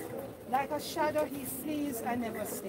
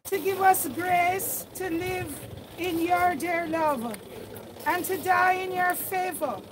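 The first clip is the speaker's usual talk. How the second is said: raised, solemn prayer voice; fervent prayer; 5 semitones higher, 6 dB louder than usual. Acted. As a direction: lift the prayer up with full voice. Prays aloud over the grave.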